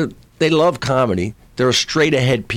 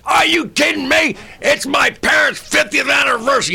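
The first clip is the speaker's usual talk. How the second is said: indignant shout; 14 semitones higher than usual. Retold or imitated. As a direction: shout it like a wronged New Yorker. New Yorkers demanding to sing.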